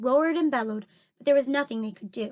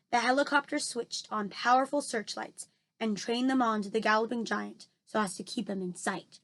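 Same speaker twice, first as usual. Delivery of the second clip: ordinary delivery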